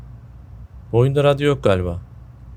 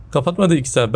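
laughing; 6 semitones higher than usual. Real, not acted. Annoyed at game, laughs.

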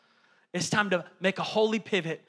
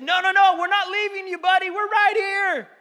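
calling out reassurance; 12 semitones higher, 5 dB louder than usual. Retold or imitated. Father reassuring panicked son.

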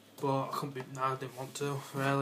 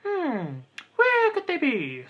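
mock puzzled voice; 12 semitones higher, 5 dB louder, slower than usual. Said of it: "and I was like".

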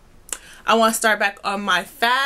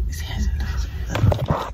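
whispering; slower than usual. Real, not acted. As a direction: whisper it behind a hand. Whispering about stranger nearby.

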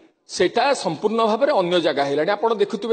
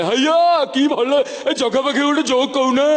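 Puri intonation; 4 semitones higher than usual. Retold or imitated. Mimicking a Puri man by the road, with Puri intonation. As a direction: lift it up in a lively Puri lilt. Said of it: "ଇଣ୍ଟୋନେସନ".

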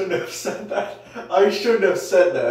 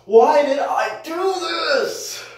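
pained shout; 10 semitones higher, slower than usual. Real, not acted. Cold on lower back.

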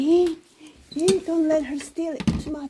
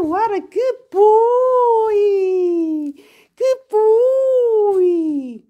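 slow, higher, tearful-sounding praise; pet baby talk; 7 semitones higher, 7 dB louder, much slower than usual. Acted. Owner praising Gilbert, slow tender baby talk. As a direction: gush with heartfelt pride.

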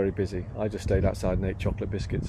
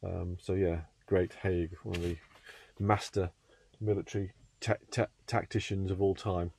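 hushed; 6 dB quieter than usual. Real, not acted. Speaks hushed in the early morning.

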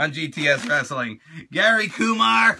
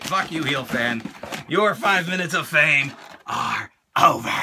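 ordinary talk

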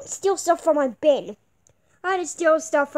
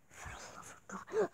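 exasperated whisper; 4 semitones higher, 15 dB quieter than usual. Acted. Exasperated aside, unvoiced.

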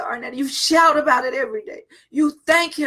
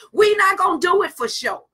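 fervent shout; 5 semitones higher than usual. Real, not acted. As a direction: cry it out to the church, full voice. Preacher shouting to the congregation.

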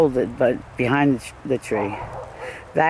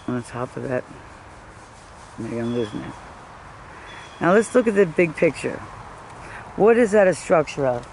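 normal talking voice

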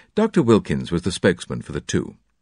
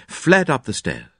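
plain reading voice

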